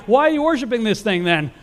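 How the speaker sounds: high, mocking sarcasm; sarcastic son. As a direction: son teasing his idol-making father. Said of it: "Abram's like".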